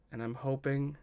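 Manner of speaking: ordinary talk, nothing marked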